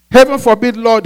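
loud, high-pitched rebuke; Peter's reprimand. Acted. Peter reprimanding his Lord, loud high rebuke. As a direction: protest forcefully. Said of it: "and reprimanded him".

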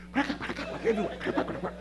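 imitated cracking noise. Imitating hospital commotion.

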